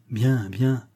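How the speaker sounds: ordinary delivery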